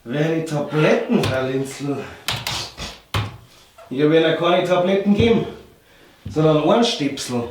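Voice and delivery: deep, calm doctor voice; low, patient family doctor. Acted. Sketch doctor explains earplugs. (tiefe, ruhige Arztstimme)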